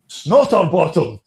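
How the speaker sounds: high, slow, loud call-out; teacher calling out. Retold or imitated. Teacher spots former pupil from the stage, calling out. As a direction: imitate a teacher hollering a nickname, stretched out.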